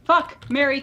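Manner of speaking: normal talking voice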